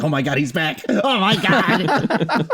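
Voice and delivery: retold fan excitement, rising high on the repeat; thrilled exclamation. Reliving the chills of seeing him return, thrilled. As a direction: drag out the second one in delight.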